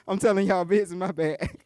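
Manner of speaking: usual speaking voice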